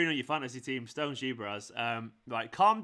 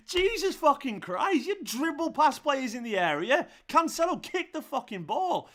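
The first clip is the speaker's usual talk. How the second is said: furious rant; 11 semitones higher, 5 dB louder than usual. Real, not acted. Ranting at Cancelo.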